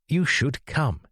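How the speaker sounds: keen and inviting, lifting the pitch; a lightly enthusiastic invitation. A narrator's reading. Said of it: "he said enthusiastically".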